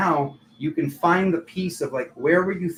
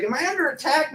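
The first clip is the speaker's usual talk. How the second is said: much higher, fretful question; mock worried; 11 semitones higher than usual. Sleepless viewer asking in mock alarm, much higher. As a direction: squeak it as if half-panicked.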